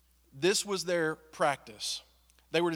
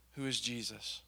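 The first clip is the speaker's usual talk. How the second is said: normal talking voice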